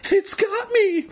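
high, panicky stammer. A narrator's reading. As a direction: stammer it out, scared. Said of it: "Evan stammered".

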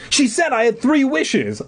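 high excited exclaim. Acted. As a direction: burst out with it. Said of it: "I exclaimed".

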